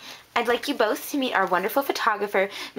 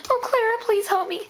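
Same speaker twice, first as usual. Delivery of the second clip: high, plaintive plea for help; pleading; 10 semitones higher than usual. Acted. Tackled diva calling to Clara for help, pleading. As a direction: beg a friend in a high voice. Begs friend after being tackled.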